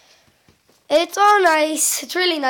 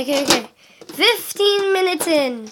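ordinary delivery

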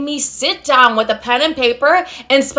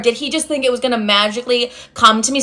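normal talking voice

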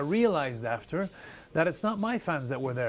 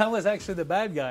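laughing; 4 semitones higher than usual. Real, not acted. Repeats line, laughing second time.

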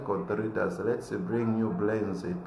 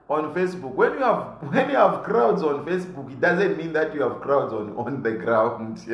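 high, loud, amused rant; laughing rant; 5 semitones higher, 5 dB louder than usual. Real, not acted. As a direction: scoff at the Facebook crowds. Mocking Facebook crowds, laughing.

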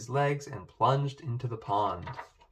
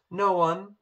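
hasty denial; 7 semitones higher, 5 dB louder than usual. A narrator's reading. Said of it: "Poppy said quickly".

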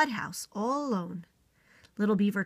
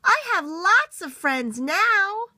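higher, louder and slow, calling out; little beaver shouting happily across the pond; very loudly; 10 semitones higher, 8 dB louder, slower than usual. Acted. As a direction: call it out across the pond with joy. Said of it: "said it again very loudly".

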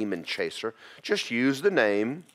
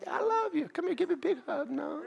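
put-on much higher, sweet cooing to a child; sweet kindly voice; 4 dB quieter than usual. Shown as a demonstration. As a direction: coo it like hugging a little one. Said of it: "look at them real kindly and go".

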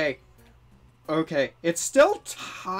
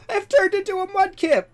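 much higher, louder shocked cry; shocked exclamation; 10 dB louder than usual. Acted. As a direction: gasp it out in disbelief. Reads shocked transformation line.

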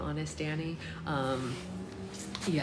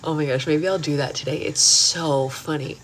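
usual speaking voice

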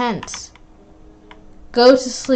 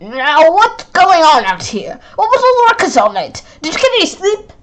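high fussy teacher; 7 semitones higher than usual. Acted. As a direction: fuss like a stern teacher. Teacher voice, pitch up.

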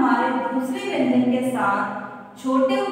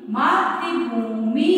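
मातृभूमि said slowly, each syllable clear; slow teaching read; much slower than usual. Shown as a demonstration. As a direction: sound out मातृभूमि slowly for children. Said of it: "छोटी की मात्रा".

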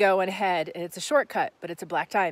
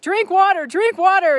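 higher, louder nagging repeat; 10 semitones higher, 7 dB louder than usual. Retold or imitated. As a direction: nag it like a pushy ex reminding you. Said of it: "my ex used to be like".

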